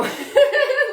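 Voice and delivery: loud, high giggle; short high burst of laughter; amused laugh. Real, not acted. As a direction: giggle out loud mid-sentence. Amused by weird visions.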